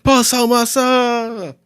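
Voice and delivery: high, slow, drawn-out cry; anguished cry. Retold or imitated. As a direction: cry the name out long and high. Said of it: "a cry from the".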